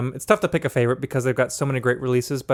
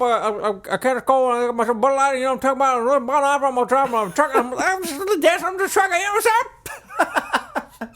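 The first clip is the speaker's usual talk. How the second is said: put-on giddy Southern good ol' boy bragging about his truck run; excited Southern accent; 12 semitones higher than usual. Podcast host riffs as an excited Southerner in exaggerated accent. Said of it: "I'm getting excited" accent".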